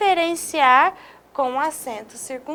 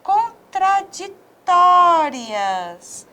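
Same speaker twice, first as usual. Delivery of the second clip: contraditórias stretched very slowly, the accented tó leaned on; slow stress demo; 4 semitones higher, 3 dB louder, much slower than usual. Shown as a demonstration. Teacher showing the accent mark to students, very slow and clear. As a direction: draw out contraditórias for the class. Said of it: "Olha essa palavra".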